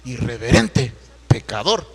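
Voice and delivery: mock scolding. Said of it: "muy abusado".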